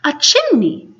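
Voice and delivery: high startled exclamation; exclaimed. Acted. Pirates discover the chimney and exclaim in surprise. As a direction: spot it and blurt it out. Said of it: "they both exclaimed".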